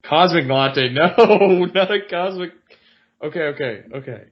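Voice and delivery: high, loud laughing protest; real laugh breaking through the reading; laughing disbelief. Real, not acted. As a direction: crack up at the silly phrase. Laughs at absurd script line.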